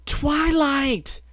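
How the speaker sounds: shy pony finally bursting out. Acted. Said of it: "A voice rarely heard raised".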